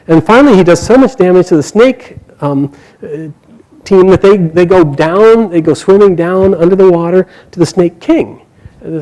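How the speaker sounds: ordinary delivery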